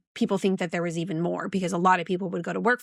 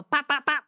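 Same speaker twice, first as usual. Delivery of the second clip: high popping gun noises; gunshot sounds; 7 semitones higher than usual. Shown as a demonstration. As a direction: pop it out like a toy gun. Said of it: "That's the only gun noise I can do".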